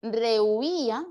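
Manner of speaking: "Rehuía" slow, with a long stressed "í"; sounded out. Shown as a demonstration. Teacher confirming an answer, "Rehuía" slowly sounded out. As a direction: stretch the vowels of "Rehuía" apart.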